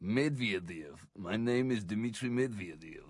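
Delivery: low, soft Russian accent put on; deep Russian accent. Introducing himself as a Russian leader, deep Russian accent. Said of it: "You've got to put on the Russian accent".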